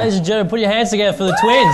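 loud, high crowd hype; host announcement. Acted. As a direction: announce it to the audience.

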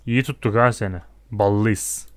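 normal talking voice

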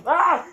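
high, loud startled scream; frightened shriek. Real, not acted. Sudden noise in haunted house.

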